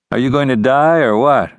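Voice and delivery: cool. A narrator's reading. Ranger to the dying outlaw, coolly. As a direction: ask it with detached impatience. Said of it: "the ranger said coolly".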